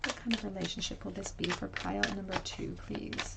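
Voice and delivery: soft, breathy question asked to the deck; hushed aside to the cards. Acted. Tarot reader quietly asking the deck about pile two, hushed.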